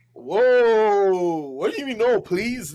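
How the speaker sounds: drawn-out whoa, then high, loud questioning; hammed-up astonishment at a puzzling answer; startled disbelief. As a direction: imitate a taken-aback host in disbelief. Steve Harvey's startled reply retold.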